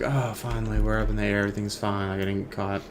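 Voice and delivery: quiet secret relief; relieved under breath. Retold or imitated. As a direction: keep it low so nobody hears. Relieved secret thought on plane.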